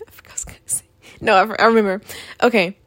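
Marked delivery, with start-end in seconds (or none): 0.1-0.8 barely audible whisper to self, after a laugh; whispered aside. Real, not acted. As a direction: whisper it under your breath, having lost the thread. Lost thought, muttering to self.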